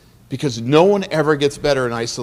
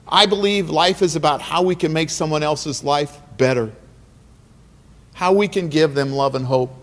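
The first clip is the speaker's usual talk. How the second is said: normal talking voice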